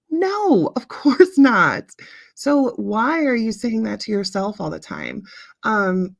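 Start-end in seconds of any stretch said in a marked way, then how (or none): 0.1-1.8 a high, louder no that breaks into a laugh, then a slow course not. Real, not acted. Answering her own question to viewers with a laughing scoff. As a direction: laugh it off as obviously absurd.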